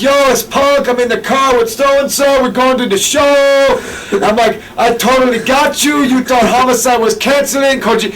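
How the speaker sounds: CM Punk impression. Posing as a wrestler to fool Gabe, high put-on voice. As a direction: pretend to be a famous wrestler on the phone. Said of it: "I didn't even use my real voice".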